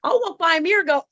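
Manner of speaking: normal talking voice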